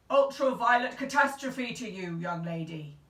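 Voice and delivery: put-on booming old man voice, very loud. A narrator's reading. Great uncle greeting the girl, booming loud. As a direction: boom it like guns at sea. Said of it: "His voice was loud".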